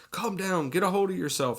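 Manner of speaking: high-pitched, insistent imitation; mock urgent scolding. Imitating the nun confronting the panicking woman, mock urgent. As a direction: play at shaking sense into someone.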